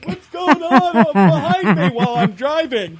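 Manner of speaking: high, put-on gawker voice, nosy and slow; playing a gawking driver; nosy rubbernecker. Mocking rubberneckers in a high, nosy gawker voice.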